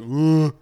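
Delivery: imitated straining groan. Podcaster imitating the actress wrestling with her accent, straining groan. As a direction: groan loudly, mimicking someone forcing out an accent. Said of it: "you could hear her kinda like".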